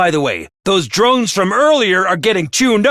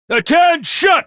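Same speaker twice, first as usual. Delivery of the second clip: commanding shout; 8 semitones higher than usual. Acted. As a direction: snap the troops to attention. Commander calls troops to order.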